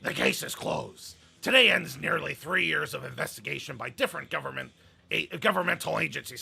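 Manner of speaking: loud, emphatic growling boss proclamation; Vince McMahon. Acted. Host reading the boss's case-closed statement, hammed-up impression. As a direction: punch it out like a blustering tycoon. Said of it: "Vince McMahon voice that I can".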